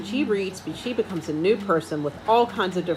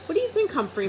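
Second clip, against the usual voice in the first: friendly question; 10 semitones higher, faster than usual. A narrator's reading. Librarian asks hamster.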